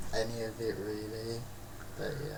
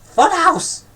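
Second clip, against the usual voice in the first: much higher, louder excited cry; mock nan shouting; 10 semitones higher, 9 dB louder, slower than usual. Dead gran calling through the psychic, high loud excited shout. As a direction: yell it like winning at bingo. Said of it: "She's saying".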